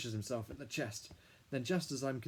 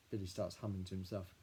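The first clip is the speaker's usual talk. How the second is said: ordinary delivery, nothing marked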